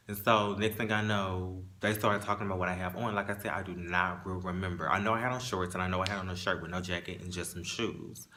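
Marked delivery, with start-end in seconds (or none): none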